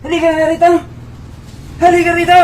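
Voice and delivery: high, loud command; loud shout. Acted. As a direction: bellow the order across the room. Husband shouting order.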